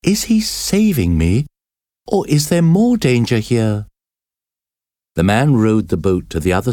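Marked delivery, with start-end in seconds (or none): 0.0-3.9 put-on higher, worried young girl's voice; anxious girl. A narrator's reading. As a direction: wonder fearfully if he is a rescuer. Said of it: "the girl asked herself".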